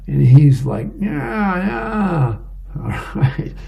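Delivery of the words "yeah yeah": high, wordless-sounding mock wail; open-jawed husband. Husband with jaw stuck open answering wife, high comic wail.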